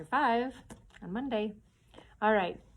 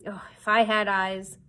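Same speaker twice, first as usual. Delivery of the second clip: louder bitter lament; frustrated cry; 7 dB louder than usual. Acted. Pew cursing his blindness, louder cry. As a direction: curse his blindness aloud. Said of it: "he cried".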